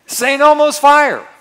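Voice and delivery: loud, high and slow, stretched out; dramatic shout. Acted. Preacher shouting his refrain as the power hits, slow and loud. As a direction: throw it out like lightning striking.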